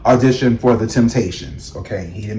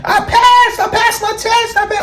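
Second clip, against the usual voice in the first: very high, squealed announcement; frantic high-pitched squeal, words tumbling out in repeats; mom screaming. Retold or imitated. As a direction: imitate mom shrieking her news. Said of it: "my mom was screaming".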